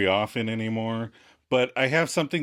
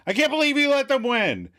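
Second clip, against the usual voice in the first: high, louder indignant outburst; Monica, ruffled; 13 semitones higher, 5 dB louder than usual. Retold or imitated. Monica scolding Chandler after the game, indignant. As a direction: imitate indignant reproach at a high pitch. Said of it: "has her Geller feathers all ruffled".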